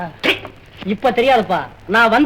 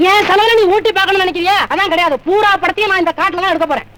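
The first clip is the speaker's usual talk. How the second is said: indignant scolding; 6 semitones higher than usual. Acted. Director scolds actress about location.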